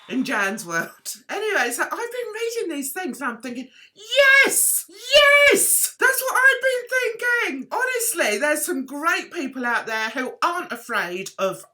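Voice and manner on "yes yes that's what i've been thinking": high, loud cry of vindication; acted-out triumphant shout; elated agreement. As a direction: exclaim it jubilantly, pitched right up. Acts out her delight reading agreement.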